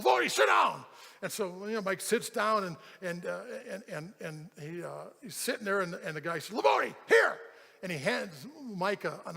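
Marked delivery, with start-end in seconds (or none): 0.0-0.8 loud, high-pitched command; foreman's shout. Retold or imitated. Foreman ordering the worker into a seat, shouted. 6.6-7.4 loud, high two-part bark; foreman's shout. Retold or imitated. Foreman thrusting an envelope at worker, barked.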